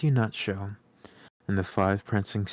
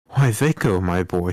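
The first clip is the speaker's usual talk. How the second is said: loud, amazed cry from the father; father's surprised exclamation to his son; cried out; 7 dB louder than usual. A narrator's reading. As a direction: call out in wonder, louder. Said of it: "he cried".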